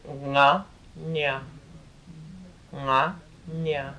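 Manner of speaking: nga at the back of the throat, then nya with the nasal moved forward like a Spanish ñ; nasal contrast. Shown as a demonstration. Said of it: "So it's moved up now, the nasal of it".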